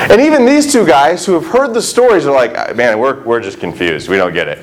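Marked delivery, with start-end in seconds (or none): 2.8-4.6 low, soft baffled shrug; mock bewildered mumble; confused disciple. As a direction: sound lost and a bit dim. Two guys voiced as confused.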